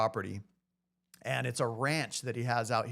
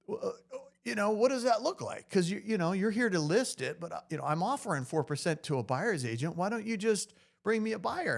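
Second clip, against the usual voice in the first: higher-pitched rancher, eager to bargain; imitating eager rancher; 7 semitones higher than usual. Rancher to the agent about a buyer's agent, higher and eager. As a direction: do the rancher, now suddenly interested and pitching a deal.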